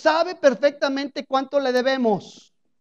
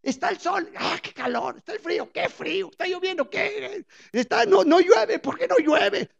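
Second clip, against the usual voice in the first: higher, whiny mock complaining that climbs higher at the end; imitating a complainer; 5 semitones higher than usual. The preacher mimics a bitter person, whiny mock complaints. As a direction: play a moaning grumbler who hates every weather. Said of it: "gente así que se queja de todo".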